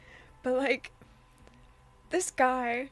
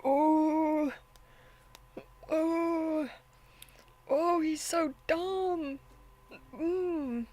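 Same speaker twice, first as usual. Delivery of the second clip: exasperated groan; 4 semitones higher, much slower than usual. Real, not acted. Exasperated at character again.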